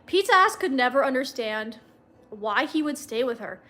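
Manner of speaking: usual speaking voice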